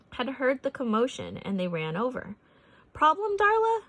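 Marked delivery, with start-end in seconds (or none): 2.9-3.8 sheriff's question. A narrator's reading. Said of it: "Earl asked".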